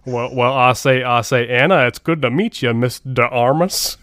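drawling Foghorn Leghorn-style Southern gentleman; hammed-up Southern drawl, slowing on the last words; bad Southern accent. Host imagines the actor greeting a co-star, bad Southern accent. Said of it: "if he tries the No Time to Die in a Southern accent".

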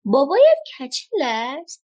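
high-pitched storybook yell; screamed call. A narrator's reading. Pippi calling behind the departing little girl, screamed. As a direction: call out high after someone walking away. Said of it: "پیپی جیغ کشید".

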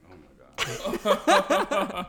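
loud, high, slow laughing cry. Real, not acted. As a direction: burst out laughing at yourself. Laughs at own accent.

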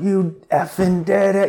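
high, slow, elated yelling; excited yell. Retold or imitated. Sponsor yelling from the front row, overjoyed. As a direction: yell it like he just watched them win. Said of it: "and he's yelling".

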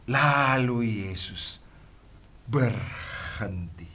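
very slow, drawn-out words; dramatic pause. Acted. Preacher slowing to show Jesus stopping. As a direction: let it land, slowly. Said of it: "dramatic slow reading".

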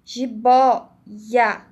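"Jiboia" with the i sounded twice, boi-ia; i pronunciado em dobro. Shown as a demonstration. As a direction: stretch "Jiboia" as if it had a double i. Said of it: "como se pronunciasse o i duas vezes". ("Jiboia" com o i dito duas vezes)

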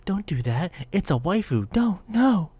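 loud, rising mock plea; hammed-up outcry of a horrified fan; protesting fans. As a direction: beg in escalating fake panic. Voices fans begging him to spare waifu.

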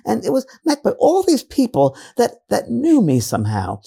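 put-on British accent. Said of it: "this British accent that she had".

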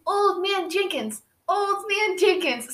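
put-on high, slow, sing-out child voice; imitated little girl. Little girl nicknaming Jacob old man, imitated child voice. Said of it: "And she's like".

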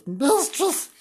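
MISTRESS drawn out in a high voice; put-on high swoop on MISTRESS; exaggerated MISTRESS. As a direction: repeat "Mistress" high and theatrical. Name repeated in put-on voice.

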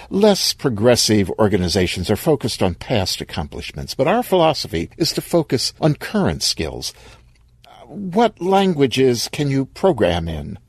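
a hint of brisk corporate cheer; lightly voiced HR interviewer. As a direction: pitch climbing, slightly bright company spiel.

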